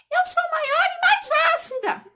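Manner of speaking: very high, slow squeaky cartoon voice. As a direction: give the drawing a tiny squeaky voice. Voicing drawn water in test. (vozinha aguda de desenho animado)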